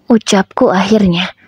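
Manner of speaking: usual speaking voice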